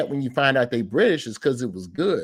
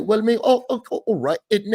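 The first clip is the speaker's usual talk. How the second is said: hammed-up British English accent, higher and louder; mock British accent; 8 semitones higher, 3 dB louder than usual. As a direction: do a playful British interview voice. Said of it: "you see him in the interview".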